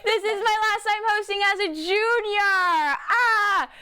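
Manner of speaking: high, hammed-up wail; mock lament. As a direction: whine theatrically about the last time. Mock lament at leaving show.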